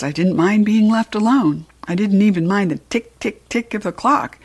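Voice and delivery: usual speaking voice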